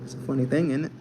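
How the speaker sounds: put-on playful British accent, lifted tag question. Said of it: "I look British".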